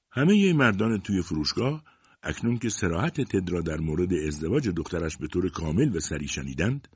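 usual speaking voice

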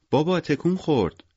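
put-on high child voice calling dad; high-pitched childish delight; excited little girl. A narrator's reading. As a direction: report it like an amazed four-year-old. Little girl tells her father.

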